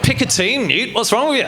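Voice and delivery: mock Australian bigot; Australian accent. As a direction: challenge them in a put-on Australian accent. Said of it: "I just feel like the Australian accent".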